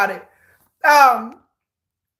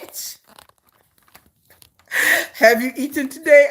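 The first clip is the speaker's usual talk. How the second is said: laughing. Retold or imitated. Mocking scammer's line.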